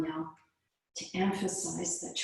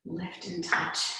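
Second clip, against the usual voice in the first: ordinary talk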